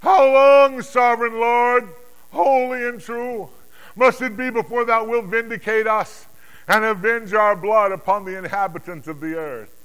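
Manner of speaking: high, loud, drawn-out plea; anguished appeal at full volume; great cry. Acted. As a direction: cry out to heaven for justice. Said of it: "they gave a great cry".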